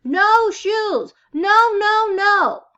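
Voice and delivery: put-on high, loud toddler scream; a child in full tantrum. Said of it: "He screamed".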